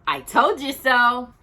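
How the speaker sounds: told-you-so. Acted. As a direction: gloat to his wife. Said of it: "he announced triumphantly".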